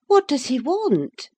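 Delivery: high-pitched lady's question; voiced lady of the house. A narrator's reading. Mistress asks her servant about a visitor, high voiced question. Narrator voices Mrs. Linton asking.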